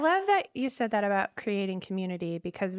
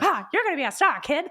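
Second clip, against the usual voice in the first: put-on fast, higher, louder old-Hollywood talk; 1940s voice; 7 semitones higher, 4 dB louder, faster than usual. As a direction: suave old film star sweet-talking. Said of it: "That's my nineteen forties voice".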